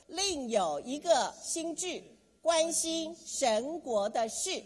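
slow, rising chant of the motto; rhyming slogan. Shown as a demonstration. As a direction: drill the slogan slowly, every syllable. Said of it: "来，再说一次". (缓慢有力地领读口号)

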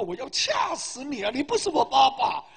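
exasperated shout, played for laughs; mock angry outburst. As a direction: imitate a man losing his temper. Acted enraged reply to the teasing.

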